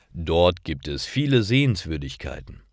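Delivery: ordinary delivery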